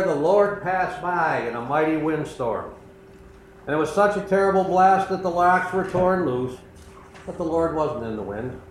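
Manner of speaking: usual speaking voice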